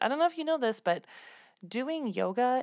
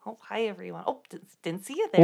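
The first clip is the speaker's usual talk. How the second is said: put-on Midwest accent; 6 dB louder, faster than usual.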